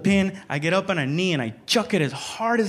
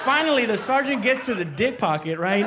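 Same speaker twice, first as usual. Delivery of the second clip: normal talking voice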